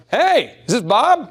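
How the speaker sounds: high, slow, easy hello like calling a buddy; bright casual "Hey" to a pal; friendly phone greeting. Shown as a demonstration. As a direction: greet him like an old friend. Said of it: "how does a friend sound".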